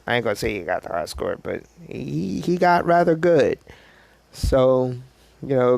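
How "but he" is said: usual speaking voice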